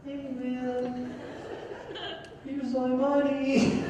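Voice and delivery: high, drawn-out, tentative voice; timid interruption. Retold or imitated. Customer interrupting the yelling window man, timidly. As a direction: edge in slowly and high, afraid to intrude.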